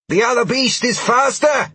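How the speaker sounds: high, loud yelling woman's voice; fierce warrior woman. A narrator's reading. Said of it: "Sheila yelled".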